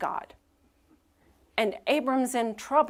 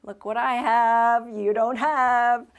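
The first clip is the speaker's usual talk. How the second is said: a mock gloating maid, higher and louder, slow; smug contempt; 3 semitones higher, 6 dB louder than usual. Pastor voicing Hagar taunting Sarai, in mock gloating. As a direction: taunt it, lightly hammed-up. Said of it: "looking at Sarai with contempt".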